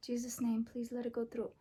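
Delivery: soft hushed plea; whispered prayer. Real, not acted. Girl praying before the second piercing, whispered.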